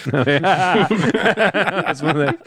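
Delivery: slow words through laughter; breaking up laughing. Real, not acted. Cracking up mid-line.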